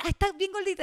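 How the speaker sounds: lightly voiced high, sweet granny; doting grandma. Repeating her grandma's loving comment, a hint of doting granny voice. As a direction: coo it like a fond grandmother. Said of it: "te decía".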